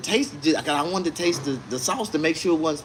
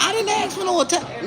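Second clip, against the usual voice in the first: high-pitched retold objection; indignant objection. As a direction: protest shrilly to a server. Retold argument with server.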